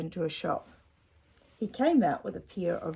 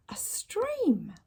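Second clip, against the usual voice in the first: much higher, breathy wonder; delighted discovery. Acted. Dad marvels at the stream to Ned, breathy and high. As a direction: gasp in wonder. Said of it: "Dad delighted".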